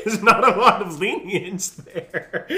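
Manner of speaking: amused, laughing through the words. Real, not acted. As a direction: let the laugh carry the line. Laughing at his own story.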